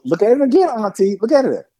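coaxing niece. Retold or imitated. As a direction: coax auntie to look again. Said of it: "the young lady's like".

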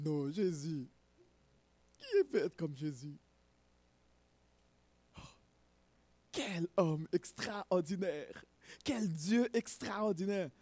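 beaucoup plus doux, presque murmuré, ralenti; émerveillement recueilli. Real, not acted. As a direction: savourer chaque mot avec adoration. Admiration recueillie devant Jésus. (le prédicateur s'émerveille de Jésus, tout doucement)

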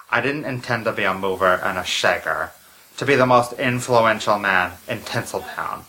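low, grave masked mastermind; low villain voice. Acted. Masked doctor reveals backstory.

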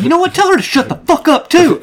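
high-pitched mock outrage; high, hammed-up telling-off; imitated angry gamer. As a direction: imitate a furious opponent, voice shooting up. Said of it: "he starts getting so angry".

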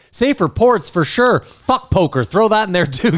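high, loud mock politician. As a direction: blurt it out like an overeager politician. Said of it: "where they're like".

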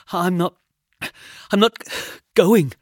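high, loud frightened refusal; scared, halting protest; stammering. A narrator's reading. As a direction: stammer it in panicked bursts. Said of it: "I stammered".

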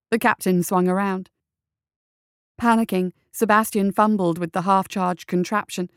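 usual speaking voice

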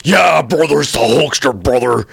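gruff wrestler shouting brother; Hulk Hogan impression. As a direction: hype it like the Hulkster. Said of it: "I don't do Hulk".

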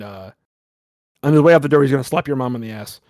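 usual speaking voice

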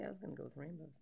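hushed confession, under the breath; whispered aside. Real, not acted. Host whispering her own pick to her co-host. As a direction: whisper it like a little secret.

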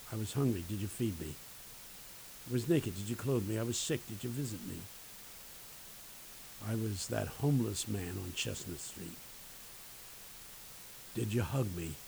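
lightly voiced Jesus, low and soft; solemn voice of Jesus. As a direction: ask gently and low, as if from afar. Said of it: "echoing down the corridors of time".

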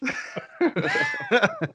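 sudden high laugh; real laugh. Real, not acted. Host laughs at his chin-up joke. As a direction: cracks up at his own joke.

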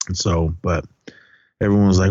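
ordinary talk, nothing marked

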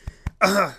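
small choking cough. Real, not acted. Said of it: "Choking a little bit here on the show".

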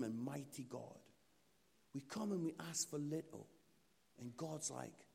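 ordinary talk, nothing marked

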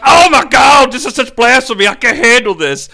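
hammed-up shout, easing off by the end; mock outrage. Caiaphas rips his cloak open, melodramatic mock shout. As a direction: explode theatrically, then let it subside.

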